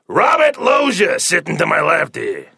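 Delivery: Robert Loggia voice. Pretend. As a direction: bellow the guest's name. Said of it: "are we gonna have to put up with that".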